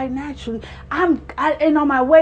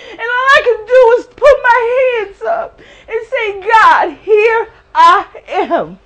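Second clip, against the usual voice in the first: high, loud tearful surrender shout; mother crying out to God in grief; 10 semitones higher, 9 dB louder than usual. Real, not acted.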